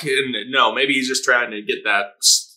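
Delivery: ordinary delivery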